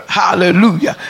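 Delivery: usual speaking voice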